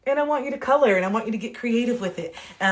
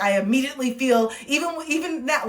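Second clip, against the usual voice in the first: ordinary talk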